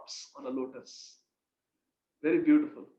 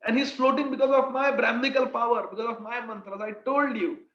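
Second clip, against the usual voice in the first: much higher, loud, boastful; Madhumangal boasting; 5 semitones higher, 3 dB louder than usual. Retold or imitated. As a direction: crow I-told-you-so at the mother. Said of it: "Then Madhumangal says".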